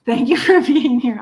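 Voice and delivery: mock scolding. Real, not acted. Teasing late Scott with mock sarcastic thanks. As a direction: playfully scold him for being late. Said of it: "I'm kidding".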